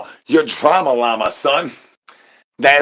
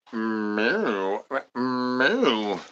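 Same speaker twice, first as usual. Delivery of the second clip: cow moo; 7 dB quieter than usual. Pretend. Mooing like a cow.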